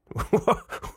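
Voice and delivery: high unvoiced giggle; a disbelieving airy laugh; breathy laugh. Real, not acted. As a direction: snort of amused disbelief. Reacting to crying comments.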